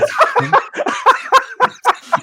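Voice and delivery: big laugh. Real, not acted. Laughing fit at the quote.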